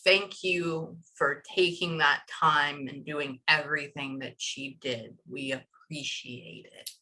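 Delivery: slow, low, softening to a hush; imitating grandmother's thanks. Medium relays grandmother's thanks to a mother, slow hushed voice. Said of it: "Like that".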